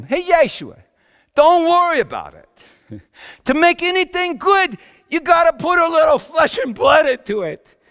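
high, loud, cheerful pep talk with a laugh; hearty encouragement. Retold or imitated. Father cheering up his cut, blistered son, big hearty encouragement. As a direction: buck him up loudly, father to son. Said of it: "it was me that said to him".

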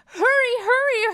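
high, loud urging; urgent shout. A narrator's reading. The two friends racing after the kite, urgent shout. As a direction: yell it to rush someone along. Characters running to save the kite.

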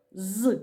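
"З" as a lone held consonant buzz; short teaching demo of the bare sound "З"; isolated sound. Shown as a demonstration. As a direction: sound out "З" by itself, not as a letter name. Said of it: "Перший звук".

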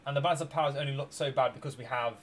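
ordinary talk, nothing marked

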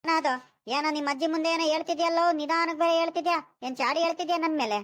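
high, loud, cross grandfather; angry scolding. Acted. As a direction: flare up and demand an answer. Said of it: "ಹೆಂಗ್ ರೇಗಾಡ್ತೈತೆ ನನ್ ಮೇಲಂತ ಈ ತಾತ".

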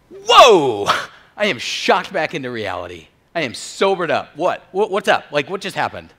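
loud, high shout of alarm settling into bewilderment; startled shock. Acted. Drunk student suddenly punched sober, loud shock. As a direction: jolt awake yelling, then rattle out baffled questions.

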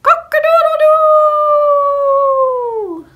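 loud, high, long-held cock-a-doodle-doo; rooster crow. A narrator's reading. Rooster waking the farmer at sunrise, crowing loudly. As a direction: crow at full volume like a rooster. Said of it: "the rooster crowed".

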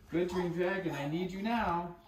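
calling out. Acted. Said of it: "called out loudly and clearly".

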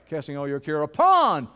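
emphatic, swooping "upon"; stress on upon. Shown as a demonstration. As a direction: build up and land hard on "upon". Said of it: "Say it with emphasis".

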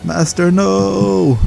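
high, slow drawn-out cry of no; anguished cry. Acted. Apprentice crying out as master risks himself. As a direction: wail it at the master.

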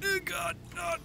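high, soft squeaked pleas; panicky gasping. Real, not acted. Player in trouble in the game, panicky high gasps. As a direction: squeeze out tiny high cries after a sharp gasp. Panting panic near the fire.

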